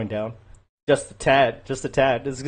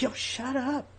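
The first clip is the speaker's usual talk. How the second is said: high, soft, urgent shushing; hushed scolding; 11 semitones higher, 10 dB quieter, slower than usual. Retold or imitated. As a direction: retell it as a hushed, high-pitched plea. Hushing his shouting wife, retold.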